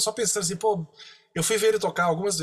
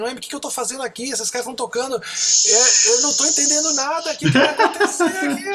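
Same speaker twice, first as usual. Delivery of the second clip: rising higher and louder in panic; desperate, near tears; 7 semitones higher, 4 dB louder than usual. Retold or imitated. Phoning home from the recording studio, overwhelmed: desperate, almost crying. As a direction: blurt it out fast, close to crying. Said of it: "aí comecei quase chorando ... Assim, desesperado".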